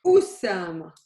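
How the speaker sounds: Usama struck off a glottal stop; with the hamza. Shown as a demonstration. Teacher showing the version she wants, glottal stop onset. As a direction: pop the U of Usama from a closed throat. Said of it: "But I want".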